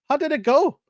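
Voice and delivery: a hint of an Irish doctor checking in. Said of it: "Let me get my Irish accent out".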